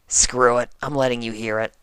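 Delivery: usual speaking voice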